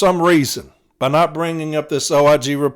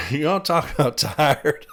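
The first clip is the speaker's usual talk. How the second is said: laughing; 4 dB quieter than usual. Real, not acted. Laughing, tired.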